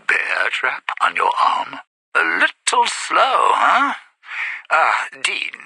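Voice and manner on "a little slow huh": high, needling jibe; condescending high-pitched question; mocking taunt. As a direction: talk down to someone dim, pitched up. Taunting a slow-witted stranger.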